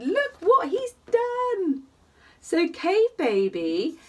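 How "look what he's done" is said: much higher, slow, drawn-out exclaim; mock astonished trumpeting voice; amazed mammoth. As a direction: marvel at the painted walls. Mammoth family exclaims at walls.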